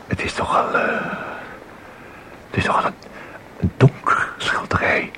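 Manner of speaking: hesitant near-whisper, breaking off; awed half-whisper, stopping and starting; hushed. Acted. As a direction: barely voice it, unsure of what you see. Hesitant visitor describing dark painting.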